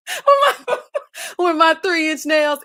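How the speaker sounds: words broken by laughter, slowed; laughing through words. Real, not acted. Reading a viewer comment, laughing through the words. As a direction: laugh out the line.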